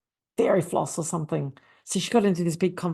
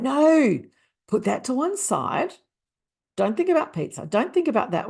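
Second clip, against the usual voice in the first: firm, raised-pitch correction; mock exasperation; 7 semitones higher than usual. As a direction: playfully scold a straying client. Coach corrects client in retold role play.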